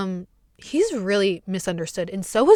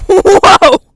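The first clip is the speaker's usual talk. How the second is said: very loud, high whoa; hammed-up stunned shout; startled whoa; 9 semitones higher, 14 dB louder than usual. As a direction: blurt a huge shocked whoa. Reacting to being screamed at.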